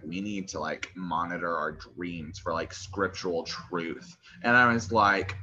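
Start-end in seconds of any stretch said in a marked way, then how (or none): 0.0-4.2 slow, soft, mock-solemn drone; mocking commenter. Quoting a churchy commenter, slow mock-solemn drone. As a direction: drag it out like a sanctimonious scold. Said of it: "comment on there and was like".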